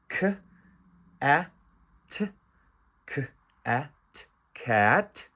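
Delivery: each sound of "cat" alone, then blended; phonics sounding out. Shown as a demonstration. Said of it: "I'm going to use the phonics".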